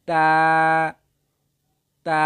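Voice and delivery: তা with tongue tip touching upper tooth roots; demonstrated letter sound. Shown as a demonstration. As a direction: hold তা long and level. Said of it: "গোড়ার সঙ্গে লাগিয়ে উচ্চারিত হয়".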